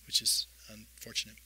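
normal talking voice

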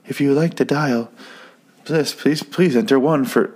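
automated system. Retold or imitated. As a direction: imitate a recorded phone prompt. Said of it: "is it one automated system".

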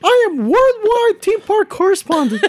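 insistent, very high mouse falsetto; Nikki Moss. Acted. Cartoon correspondent correcting his name to the co-host, high insistent falsetto. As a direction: correct them in a squeaky cartoon voice. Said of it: "this is Nikki Moss".

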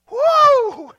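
long, high, loud "woo"; soaring celebratory whoop; exuberant whoop. Real, not acted. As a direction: let out a big praise holler. Whoops in praise.

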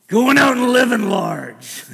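loud, high, slow exuberant cheer. Retold or imitated. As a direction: call it out like a proud dad celebrating. Proud dad cheering son on.

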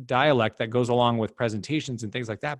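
ordinary delivery

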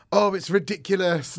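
ordinary talk

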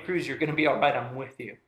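reassuring. Retold or imitated. Calming scared son in water.

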